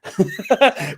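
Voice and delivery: short real laugh; a high burst of laughter. Real, not acted. Laughs at his own line.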